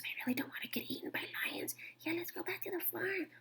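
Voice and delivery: soft, fast frightened whisper. A narrator's reading. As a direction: hurry the words in a hush. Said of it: "whispered to one another".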